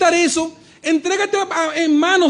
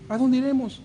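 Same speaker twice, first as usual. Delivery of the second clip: much softer echo of dónde iremos; 3 semitones lower, 7 dB quieter than usual. Retold or imitated. Preacher echoing the children's question, soft and fading. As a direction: let it fall away almost to yourself.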